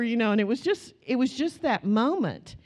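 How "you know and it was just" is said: ordinary talk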